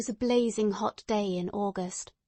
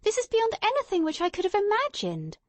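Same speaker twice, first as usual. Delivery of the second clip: astonished; 12 semitones higher, 3 dB louder than usual. Acted. Watson astonished at Holmes.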